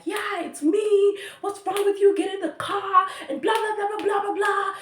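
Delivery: high-pitched imitation of an angry yell; mock yelling. Angry driver yelling at his passenger, high mock yell. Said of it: "He started yelling at me".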